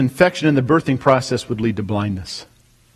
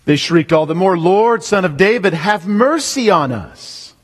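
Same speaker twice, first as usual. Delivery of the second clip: preaching; 5 semitones higher, slower than usual. Shown as a demonstration. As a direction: lift it and cry it out. Repeats line with more force.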